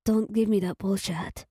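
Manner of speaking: ordinary delivery, nothing marked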